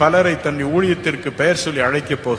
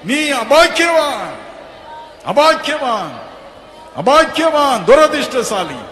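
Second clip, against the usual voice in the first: stern rebuke; 10 semitones higher, 5 dB louder than usual. Acted. Preaching rebuke.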